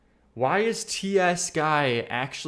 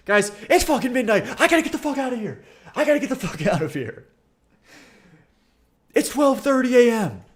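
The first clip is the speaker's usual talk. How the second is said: high, loud, laughing complaint about the hour; giddy exasperation; 12 semitones higher, 7 dB louder than usual. Real, not acted. Streamer groaning to chat about midnight, high and loud.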